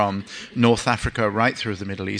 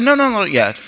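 mock husband; 10 semitones higher, 4 dB louder than usual. Husband holds wife back.

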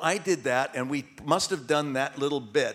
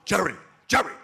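mock yelling, climbing higher with each try; Larry David; 5 semitones higher than usual. Director telling the star to stop laughing, building to a yell. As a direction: imitate an exasperated director calling an actor to order. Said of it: "And he would yell at him".

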